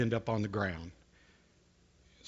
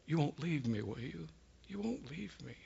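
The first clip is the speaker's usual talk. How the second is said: soft frightened plea, much softer; pleading whisper; 3 semitones higher, 10 dB quieter than usual. Retold or imitated. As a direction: beg softly not to be left alone. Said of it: "her voice started changing".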